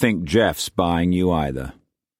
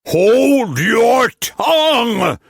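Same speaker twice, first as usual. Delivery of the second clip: high, loud, slow furious shout; 7 dB louder, much slower than usual. A narrator's reading. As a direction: roar it out at full force. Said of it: "Tillman shouted".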